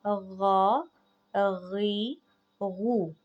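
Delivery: गा गी गू heavy and voiced from the throat top; ghain with vowels. Shown as a demonstration. Teacher drilling vowel marks for students, heavy throaty. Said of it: "ऐसे पढ़े जाएंगे".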